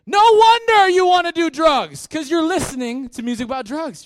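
high, loud exasperated cry; loud exclamation. Real, not acted. Pastor exclaims at the congregation, high and loud. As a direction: call them out. Pastor rebukes listening habits.

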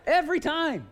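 loud, high, emphatic outburst; comic exasperation at full voice; exasperated exclamation. Real, not acted. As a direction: belt out the punchline. Punchline repeated with great emphasis.

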